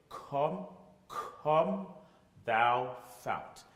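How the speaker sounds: "come" repeated with a crisp K, rising; teacher drilling the K in "come"; hard K on come. Shown as a demonstration. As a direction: spit out the K in "come". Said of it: "a K sound to sound it".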